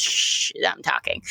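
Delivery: whispered shush, dismissive; hushing whisper. Acted. River whispering to silence Rodney, dismissive hush. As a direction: hush him in a low whisper. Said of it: "And she goes".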